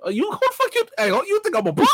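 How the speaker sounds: hammed-up street beef, high and rising; mock tough guy. Pretending to pick a fight with his cohost, mock angry. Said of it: "Challenge me like I won't".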